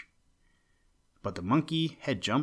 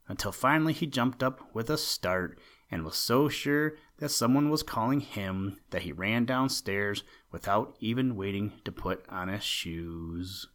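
ordinary delivery

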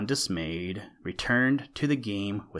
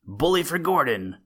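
a cheer called out, louder and higher; shouted cheer; 6 semitones higher, 4 dB louder than usual. A narrator's reading. A fan cheering for Wayne from the stand, shouted. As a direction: hail him across the stand. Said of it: "and shouted".

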